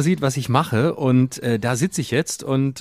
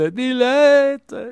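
gushing; 12 semitones higher than usual. Pretend. Gushing about Cologne people.

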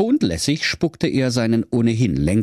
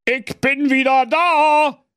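high, slow, loud call; booming warrior king; 4 dB louder, slower than usual. Acted. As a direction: call out a homecoming from the stairs. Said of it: "rief er".

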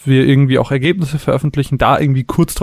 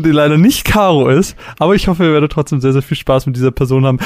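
ordinary talk, nothing marked

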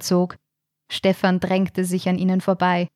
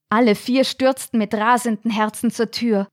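usual speaking voice

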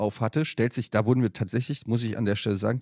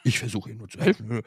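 lightly voiced old mother, mumbling to herself; mother muttering; 3 semitones lower, 4 dB quieter than usual. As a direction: imitate mom's offended self-talk, a hint of it. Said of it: "Die hört, die so".

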